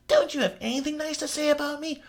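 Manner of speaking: high petulant voice of the captor; whiny Carl. Acted. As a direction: plead like a hurt manchild. Carl whining, wounded.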